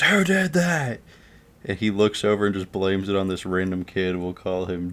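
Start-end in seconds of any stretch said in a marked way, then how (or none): 0.0-1.0 high-pitched outraged demand; angry teacher. Retold or imitated. Teacher to class after being hit by a ball, outraged. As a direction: imitate a teacher's indignant outburst.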